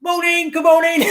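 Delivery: high, laughing village greeting; imitating friendly Derbyshire locals; cheery villagers. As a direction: call out good morning to everyone you pass. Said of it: "was saying".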